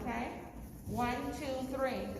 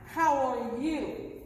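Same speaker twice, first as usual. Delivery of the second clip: slow, clear greeting; 4 dB louder, much slower than usual. Shown as a demonstration. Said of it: "When someone asks you".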